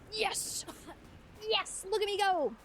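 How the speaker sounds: high, gleeful self-congratulation; triumphant cheer. Retold or imitated. Tourist proud of speaking Japanese, triumphant. Retold pride at managing Japanese.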